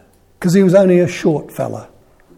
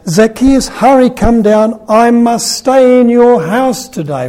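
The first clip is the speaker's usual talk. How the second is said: louder, higher, slowed call up into the tree; Jesus calling out; 7 semitones higher, 6 dB louder than usual. A narrator's reading. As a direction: call him down warmly but firmly, drawn out. Jesus calling Zacchaeus down again.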